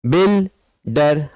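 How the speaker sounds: "builder" with a gap between buil and der; split into syllables. Shown as a demonstration. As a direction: say "builder" as two separate beats. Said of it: "यहाँ पर दो syllable है देखिए".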